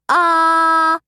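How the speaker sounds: one long steady ah; held ah. A narrator's reading. Dima showing what the doctor made her say, a long ah. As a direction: hold an ah like at the doctor. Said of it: "make me stick out my tongue and say".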